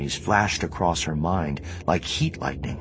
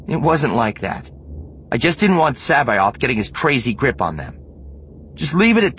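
curt; 10 semitones higher, 6 dB louder than usual. Acted. Mara shuts the topic down.

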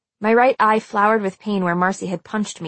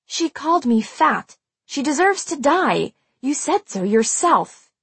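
higher, heated, emphatic protest; furious protest; 7 semitones higher than usual. A narrator's reading. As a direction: protest hotly, leaning on the words.

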